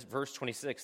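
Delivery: ordinary delivery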